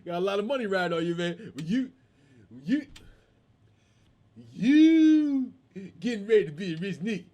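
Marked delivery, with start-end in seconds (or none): 1.6-7.2 imitated stutter, stuck on the first word; mock stammer breaking into a laugh; can't speak well. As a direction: strain at the word, then rush it out. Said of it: "This dude can't speak well".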